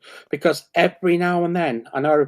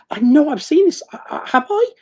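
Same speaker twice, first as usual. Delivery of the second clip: high, louder, frantic self-questioning; excited doubt; 9 semitones higher, 4 dB louder than usual. Retold or imitated. Digging through old reference books, high frantic excitement. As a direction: sound feverish and unsure. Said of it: "dragging old reference books off the shelf going".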